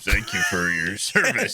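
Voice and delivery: slow, put-on reverent salute; mock solemn tribute. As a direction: draw it out like a joking salute. Mock salute to the famous customer.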